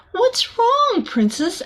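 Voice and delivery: a high, gentle character voice for the librarian; a hint of a sweet storybook librarian.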